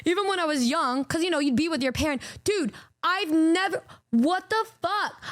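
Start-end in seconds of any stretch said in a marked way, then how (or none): 2.4-5.2 slow, higher, drawn-out outrage; can't believe it, stretch it out; stunned disbelief. Real, not acted. Shocked at being asked for adult.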